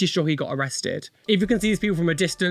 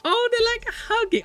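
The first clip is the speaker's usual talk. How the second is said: high squeal over something cute; high cutesy gush; cooing delight. Real, not acted. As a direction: coo at it like a puppy. Finds a tattoo sweet.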